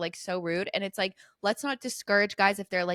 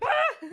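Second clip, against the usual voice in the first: high, sudden yelp of fright; retold startled shriek. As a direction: yelp as if someone crept up on you. Acts out being startled.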